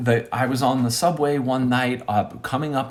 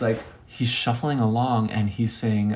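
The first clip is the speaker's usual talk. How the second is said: ordinary talk, nothing marked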